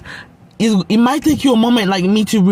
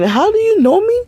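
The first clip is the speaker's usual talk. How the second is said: disbelieving squeak; 13 semitones higher than usual. Retold or imitated. Confronting a stranger who knows her.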